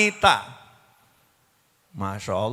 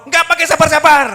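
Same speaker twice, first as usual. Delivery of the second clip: angry shout; 9 semitones higher, 8 dB louder than usual. Acted. Wife cuts husband off.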